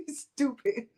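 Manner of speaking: laughing it out. Real, not acted. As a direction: scoff through a laugh. Laughing at speaker.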